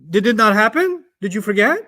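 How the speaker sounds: usual speaking voice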